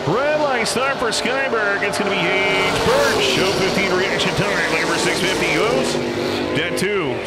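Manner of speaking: high, loud, slow play-by-play call; excited announcer calling the start; live race call. Acted. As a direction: belt out the launch like a drag strip announcer. Calls the drag race live.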